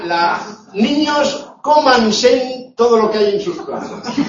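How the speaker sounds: a laughing put-on scolding; mock vulgar command. Shown as a demonstration. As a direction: playfully bark the vulgar imperative at kids. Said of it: "es vulgar".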